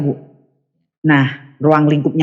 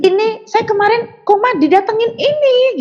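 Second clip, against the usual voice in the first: insistent retelling. Retold or imitated. Insisting to the nurse.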